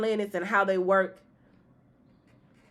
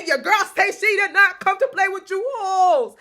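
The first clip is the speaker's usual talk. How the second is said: much higher and louder, full sassy bragging voice; sassy hype girl; 13 semitones higher, 10 dB louder than usual. Retold or imitated. Hyping up the client, loud sassy bragging voice. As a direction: belt it like a proud bestie bragging. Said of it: "I was like".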